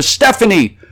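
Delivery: high, loud, desperate plea; frantic high-pitched shouted appeal; crying out. A narrator's reading. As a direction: cry out urgently to a loved one. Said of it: "I cried out to her".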